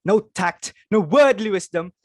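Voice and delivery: high, loud rebuke; scolding. Acted. Mother scolds daughter over the guest mix-up, loudly. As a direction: tear into her for the blunder.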